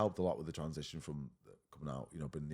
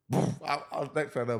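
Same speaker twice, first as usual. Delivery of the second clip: usual speaking voice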